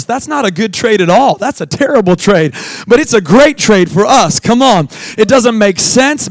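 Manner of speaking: preaching. Real, not acted. Pastor urging the church, loud and fervent. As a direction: drive it home to the crowd.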